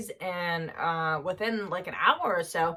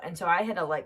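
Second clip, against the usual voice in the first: ordinary delivery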